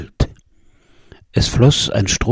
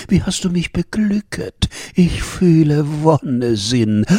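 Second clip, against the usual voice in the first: higher, enraptured shepherdess voice; entzückte Schäferin; 5 semitones higher than usual. A narrator's reading. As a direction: play her swept away with delight. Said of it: "rief entzücket die schöne Schäferin". (entzückte Schäferin, mit hoher Stimme)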